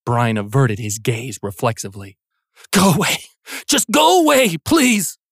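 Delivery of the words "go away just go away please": terrified plea. Acted. Brian begs the monster.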